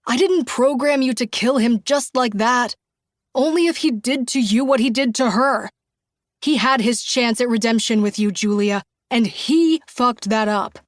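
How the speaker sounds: loud, heated insistence. A narrator's reading. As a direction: press it hard and angry. Andy's heated explanation.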